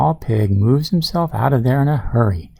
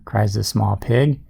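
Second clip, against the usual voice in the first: ordinary delivery, nothing marked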